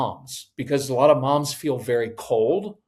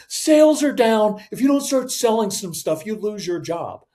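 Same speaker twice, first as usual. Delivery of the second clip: panicky threatening boss; 7 semitones higher, 5 dB louder than usual. Retold or imitated. Boss yelling at staff about sales, mock shout.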